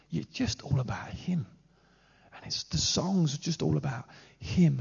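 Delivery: a soft, breathy whisper of devotion; hushed. Real, not acted. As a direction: drop to an awed whisper. Preacher drops to a hushed whisper.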